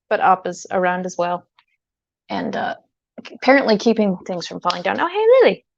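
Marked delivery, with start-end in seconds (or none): none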